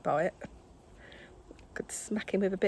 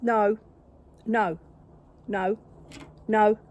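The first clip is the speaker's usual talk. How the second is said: loud repeated scolding no; firm loud no; 7 dB louder, slower than usual. Real, not acted. Owner scolding the cat on the table, loud repeated no.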